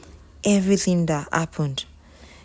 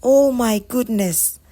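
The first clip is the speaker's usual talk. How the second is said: raised, louder, drawn-out exclamation; restrained cry of shock, higher and louder; mild scream; 6 semitones higher, 6 dB louder, slower than usual. A narrator's reading. Ego hearing Tolani's confession, a mild scream. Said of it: "Ego screamed".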